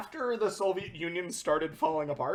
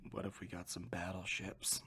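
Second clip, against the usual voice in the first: very low and soft, a sheepish murmur; mock timid mumble; 12 semitones lower, 17 dB quieter than usual. Boardroom nobody timidly pitching battleships, very low and soft. Quiet guy in corner pitches idea.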